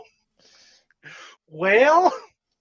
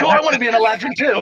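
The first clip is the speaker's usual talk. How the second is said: mock-eager, higher and louder; eager fellow player; 8 semitones higher, 7 dB louder, faster than usual. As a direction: toss it off as a teasing impression of a keen player. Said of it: "He's like".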